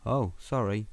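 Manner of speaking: ordinary talk, nothing marked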